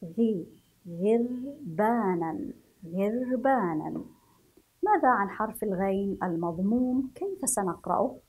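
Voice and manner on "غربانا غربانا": غربانا said slowly, the غ clear; slow demo. Shown as a demonstration. Teacher to pupils, modelling غربانا slowly. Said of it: "انظروا إلى حرف الغين المكسور".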